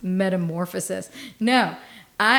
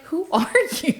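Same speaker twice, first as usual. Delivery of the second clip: higher, baffled with a laugh breaking in; 7 semitones higher than usual. Retold or imitated. Retelling the store checkout, confused and baffled. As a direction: play it dazed and clueless, half laughing. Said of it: "And I'm like".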